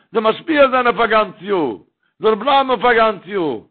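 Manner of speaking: impassioned preaching. Acted. Preaching builds to a refrain.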